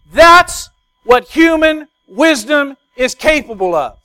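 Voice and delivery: loud, high, slow preaching; preaching at full force. Acted. Preacher hammering the main point to the congregation, loud and slow.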